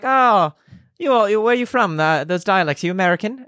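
high, imitated British accent; British stranger. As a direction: retell it as an eager British gent. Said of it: "was in London once in college".

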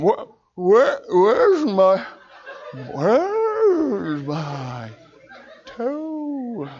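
high, slurred and drifting off; drugged, fading out. Retold or imitated. Sedated patient drifting off while still asking the doctor. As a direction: sink under the anesthetic mid-question. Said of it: "I'm going".

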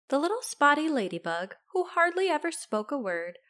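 ordinary delivery, nothing marked

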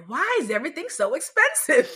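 high, loud exasperated complaint; out loud for all. Retold or imitated. Shopper griping at Costco for people to hear, loud exasperation. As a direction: complain so the whole store hears. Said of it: "I said out loud for people to hear".

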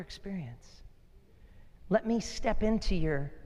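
gently invite, leaving space after each phrase; soft, unhurried voice of God; tender invitation. Acted. Voicing God's tender invitation.

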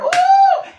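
high, loud whoop of excitement; joyful woo. Real, not acted. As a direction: let out a big preacher's whoop. Preacher's exclamation in full flow.

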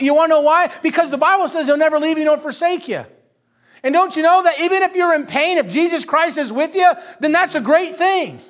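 high, loud, impassioned sermon; full-force preaching. Real, not acted. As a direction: drive the promise home to the congregation.

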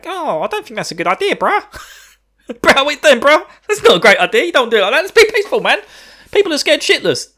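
high-pitched, protesting mock voice; Larsa. Younger brother objecting to the villain's plan, high mock protest. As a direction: plead loudly in a high cartoonish voice. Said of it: "and Larsa going".

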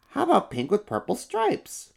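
high, curious little monster voice; an eager small kid-monster. Acted. First little monster asks about the fur color.

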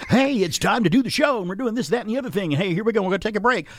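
high, bright on-air energy; upbeat on-air host. Retold or imitated. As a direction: pump it up like a radio host. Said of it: "He's Lurch from the Addams Family".

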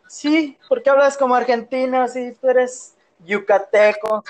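mock a puzzled listener, pitch up; imitating a listener.

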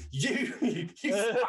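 a laughing, rising yell of you fuck; laughing curse. Retold or imitated. Retold victim laughing, swearing.

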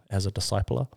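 ordinary talk

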